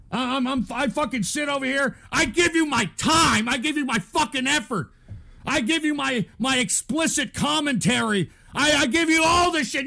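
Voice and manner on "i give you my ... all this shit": raised, higher-pitched grievance; angry exasperation. Real, not acted. Streamer listing sacrifices to ungrateful viewers, angry and building. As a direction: list what you give them, building towards a yell.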